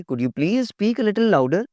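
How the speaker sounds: slow courteous question; teacher showing the polite way, slow; polite request. Shown as a demonstration. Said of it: "क्या कहेंगे?".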